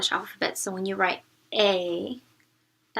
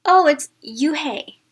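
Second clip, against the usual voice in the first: slow, louder mocking drawl of the name; teasing boy; 4 semitones higher, 8 dB louder, slower than usual. A narrator's reading. Boy on the bus teasing the new girl over her name, slow and mocking. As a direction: stretch it out to tease her.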